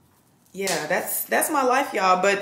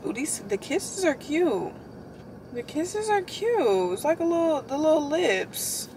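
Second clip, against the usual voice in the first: cooing admiration; 7 semitones higher, 3 dB quieter, slower than usual. Real, not acted.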